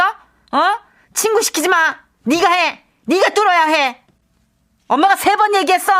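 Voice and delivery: high, firm repeated orders; insistent scolding. Acted. Mother repeating her orders to her son, high and insistent. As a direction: drill it into him.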